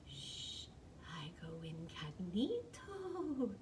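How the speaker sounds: slow, hushed stage whisper; conspiratorial hush, very soft; secretive whisper. A narrator's reading. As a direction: shush them, then whisper slowly. Kitten hero claims secret disguise.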